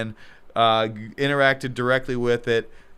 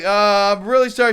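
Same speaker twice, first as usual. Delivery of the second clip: nervous apology; 7 semitones higher, 4 dB louder than usual. Retold or imitated. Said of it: "And then I'm like".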